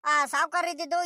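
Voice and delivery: ordinary talk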